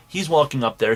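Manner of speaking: ordinary delivery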